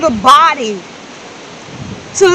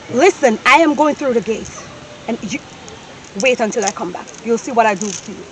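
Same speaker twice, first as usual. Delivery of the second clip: quiet threat; 6 semitones lower, 10 dB quieter than usual. Acted. Madam's low quiet threat to maid.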